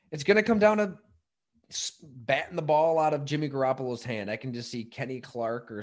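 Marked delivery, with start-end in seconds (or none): 0.1-4.0 louder, higher, hammering it home; emphatic insistence. Real, not acted. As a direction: insist it like a hot take. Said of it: "but it's true".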